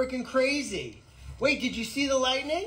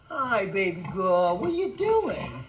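slow pet talk; 2 semitones lower, slower than usual. Real, not acted. Cooing to her animals.

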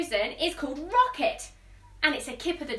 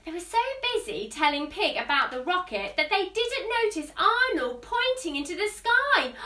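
usual speaking voice

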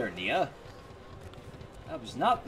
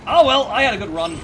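loud resigned cry; 5 semitones higher, 10 dB louder than usual. Real, not acted.

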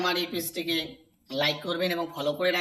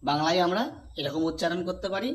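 ordinary delivery

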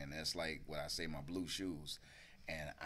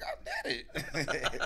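triumphant laugh; 9 semitones higher, much slower than usual. Retold or imitated. Triumphant after sneaking in.

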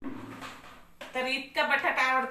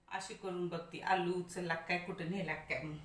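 ordinary talk

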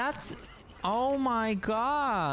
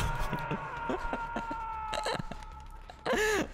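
high, drawn-out laughing squeal; long laugh that sounds like crying; high laughing fit; 10 semitones higher, 4 dB quieter than usual. Real, not acted. Laughs at the others going.

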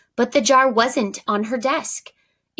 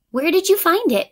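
high, louder excited ask; breathless question; 9 semitones higher, 5 dB louder than usual. A narrator's reading. Eunhae asks Joey about the jar, breathless and high. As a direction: rush the words out, amazed. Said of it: "asked Eunhae breathlessly".

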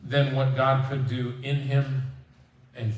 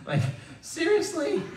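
high-pitched disbelief; incredulous. Real, not acted. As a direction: squeak it out in disbelief. Mock disbelief at Jonah's happiness.

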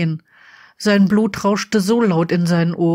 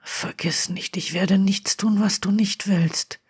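leise, heiser gehaucht; 5 dB quieter than usual. A narrator's reading. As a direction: mit heiserer, gedämpfter Stimme. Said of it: "sagte Draco mit heiserer Stimme". (Draco versichert Harry leise und heiser)

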